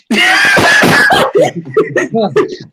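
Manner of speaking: loud, high-pitched burst of laughter; shrieking laughter. Real, not acted. Listener cracking up at a mate's egg and spoon jibe, shrieking laughter.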